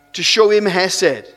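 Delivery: ordinary delivery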